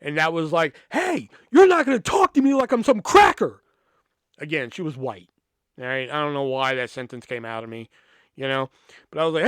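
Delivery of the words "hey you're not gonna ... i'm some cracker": high, loud angry comeback; snapping back. Retold or imitated. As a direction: lose it and fire back at the teacher. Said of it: "That's when I fucking snapped".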